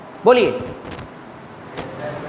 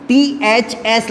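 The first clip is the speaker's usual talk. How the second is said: teacher dictating the suffix slowly; slow spelled out; much slower than usual. Shown as a demonstration. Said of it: "spells suffix slowly".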